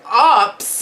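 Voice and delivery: high, loud shocked question; alarmed echo. Retold or imitated. Patient shocked when the doctor says oops, loud alarmed cry. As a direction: throw the word back in alarm.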